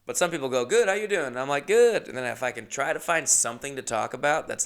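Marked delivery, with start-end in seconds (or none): 0.6-1.3 high, friendly passenger voice; acted sociable small talk; chatty reply. 1.7-2.1 high, loud, perky answer; over-keen, chipper one-word answer; eager reply. Retold or imitated. Driver's eager reply, acted.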